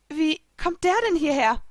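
high-pitched, puzzled question; confused. A narrator's reading. Young queen spotting a floating tree, confused. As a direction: ask it baffled, pitch jumping up. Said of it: "fragte ich verwirrt".

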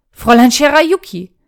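loud protesting call of her name; urgent interjection. A narrator's reading. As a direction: cut in sharply to object. Cuts in to object.

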